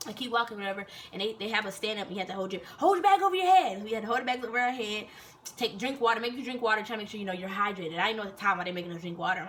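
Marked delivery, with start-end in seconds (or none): 2.8-3.8 high, loud command; sergeant's shouted order. Retold or imitated. Sergeant ordering recruits to lift bags, loud high command. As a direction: call it out like a drill instructor.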